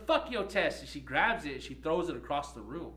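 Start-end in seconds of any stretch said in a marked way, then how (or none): 0.1-0.8 loud, high curse; imitating the student's furious curse; angry outburst. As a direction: spit it out in defiance. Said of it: "She goes".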